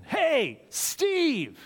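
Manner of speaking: high shouted call across a stadium; a stranger hollering across the ballpark. Acted. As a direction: yell the name from far off. Joke, the call a third time.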